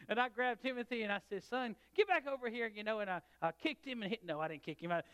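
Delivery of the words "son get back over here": calling out to son. Retold or imitated. Father calling son away.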